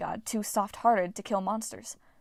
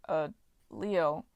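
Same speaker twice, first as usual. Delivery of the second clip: nervous; 4 semitones lower than usual. A narrator's reading. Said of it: "Jason asked nervously".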